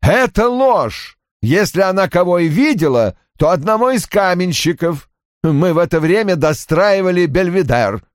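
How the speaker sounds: put-on high-pitched, emphatic older father; Roy's agitated character voice. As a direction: play an indignant father, pitched well up.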